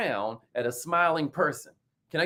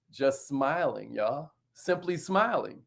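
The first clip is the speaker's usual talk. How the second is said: soft, slow and low; gentle; 5 semitones lower, 6 dB quieter, slower than usual. Real, not acted. Telling viewers the third tip, soft and slow. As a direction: say it softly, like a smile.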